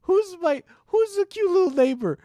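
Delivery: very high squeaky cooing; squeaky baby talk. Pretend. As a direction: squeal it like fussing over a puppy.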